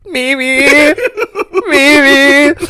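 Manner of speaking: embarrassed giggle through the word; giggling maybe. Real, not acted. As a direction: laugh it out coyly. Laughing embarrassed answer.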